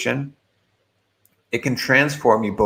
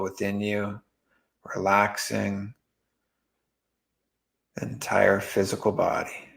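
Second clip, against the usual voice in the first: guided meditation; 5 dB quieter than usual. Real, not acted. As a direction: guide them gently into relaxing, with long pauses.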